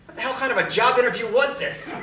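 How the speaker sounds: high, loud outburst of disbelief; loud incredulity. Acted. Applicant reacting to a bizarre job interview, loud disbelief. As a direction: exclaim it, baffled and indignant.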